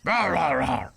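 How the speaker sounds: low, loud mock lion roar. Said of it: "He was shouty and proud".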